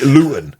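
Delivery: Luton with a glottal stop for the T; dropped T. Shown as a demonstration. Actor showing his old hometown speech, Luton with dropped T. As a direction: say Luton without sounding the T. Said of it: "I used to drop my T's".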